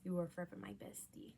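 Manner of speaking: soft unvoiced whisper; tender whispered aside. Real, not acted. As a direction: whisper it lovingly. Whispered affection for mom.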